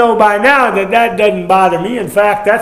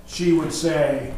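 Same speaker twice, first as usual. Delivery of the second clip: usual speaking voice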